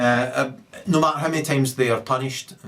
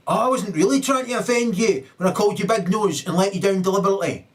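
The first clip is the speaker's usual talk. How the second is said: mock innocent excuse; 8 semitones higher, 4 dB louder than usual. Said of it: "So that".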